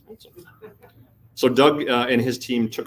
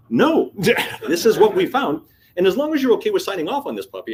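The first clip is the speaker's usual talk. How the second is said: defiant pushback; 10 semitones higher, 7 dB louder than usual. Retold or imitated. Tim refusing to change findings for his VP, defiant. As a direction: push back in a high voice, half laughing. Standing firm against angry VP.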